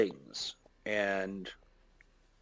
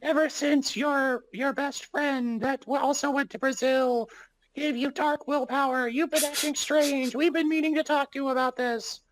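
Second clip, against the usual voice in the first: very high, thin put-on voice; high squeaky voice; 13 semitones higher than usual. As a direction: pitch way up into a squeaky voice. Friend voices Brazil friend.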